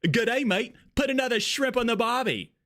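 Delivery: high-pitched, put-on Australian accent; hammed-up Australian accent. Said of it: "Oh, that's a funny accent".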